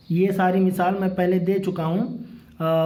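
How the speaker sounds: ordinary talk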